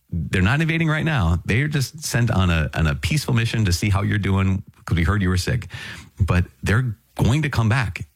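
ordinary delivery

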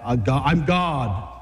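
low, slow, self-assured; swaggering declaration. Acted. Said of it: "How else do you say that?".

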